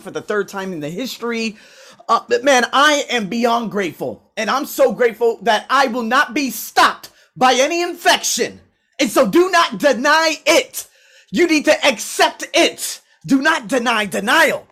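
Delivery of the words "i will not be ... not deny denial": slow, raised preaching, each word hammered; preacher mode. Real, not acted. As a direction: preach it like a pulpit sermon. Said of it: "here I am in a preacher mode".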